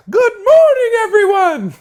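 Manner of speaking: very high, loud sing-song cartoon voice; Mister Bill voice. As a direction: squeal it sweetly like a cartoon character. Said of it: "He sound like Mister Bill".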